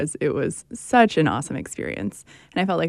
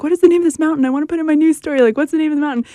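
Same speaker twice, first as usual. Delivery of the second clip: higher, louder re-enacted eager question; eager asking; 8 semitones higher, 6 dB louder, much faster than usual. Reporter asking locals for her news story, eager. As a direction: ask around keenly, pitched up and loud.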